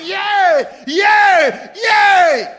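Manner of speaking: high, loud mock arena crowd cheering; bloodthirsty crowd cheer. Preacher mimicking arena crowd, high loud cheering. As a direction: cheer like a cruel crowd watching the lions. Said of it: "a whole arena of people shouting".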